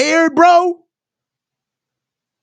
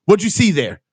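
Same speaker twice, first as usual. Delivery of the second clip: imitating reporter Grant; 8 semitones lower, faster than usual. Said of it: "All Grant said in there was".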